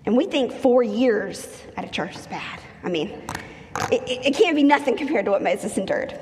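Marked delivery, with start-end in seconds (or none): none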